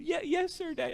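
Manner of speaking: high, soft imitation of a small boy; small obedient child's voice, imitated; cute little son. Four-year-old promising Daddy to obey, cute little-boy voice. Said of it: "Cutest thing".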